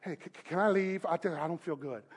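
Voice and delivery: weak, stammering, very soft mock plea; bleeding worshipper. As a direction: imitate a faint wounded man, quick and low. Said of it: "imagine the guy bleeding".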